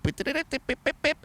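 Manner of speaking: high staccato pips mimicking a tune; high-pitched beeping imitation of music; imitated song beeps. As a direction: pip out a silly song intro in a high pitch. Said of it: "like, song starts".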